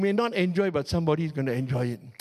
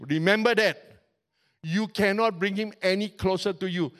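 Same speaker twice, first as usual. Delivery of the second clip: ordinary talk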